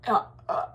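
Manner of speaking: whispered, breath-only "uh" puffs; voiceless eerie huffs; creepy panting. Retold or imitated. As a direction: imitate the lurker's excited breathing. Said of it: "and, like".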